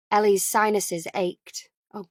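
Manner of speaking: plain reading voice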